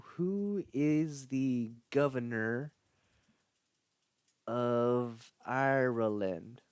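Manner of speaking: slow, stretched-out put-on voice. Answering the chat's prompt in a silly voice. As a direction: ask it in a hammed-up character voice. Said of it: "Ask me something".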